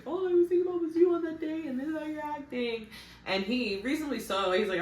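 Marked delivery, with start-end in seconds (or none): 0.0-2.9 high, fast, hammed-up crying plea; a parody of a tearful accusation, pitched high; mock teary whine. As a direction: mimic her weepy complaint, way up high and loud. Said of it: "crying and is like".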